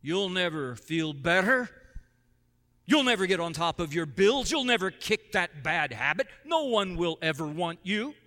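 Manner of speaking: a hint of a menacing mountain; rumbling mountain voice. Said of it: "you'll hear the mountain's voice".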